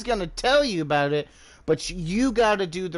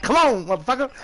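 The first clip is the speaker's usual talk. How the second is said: high, loud mock challenge; hammed-up yelling Texan; trigger-happy Texan; 12 semitones higher, 6 dB louder than usual. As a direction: call out a fight like an itchy gunslinger. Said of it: "They're like".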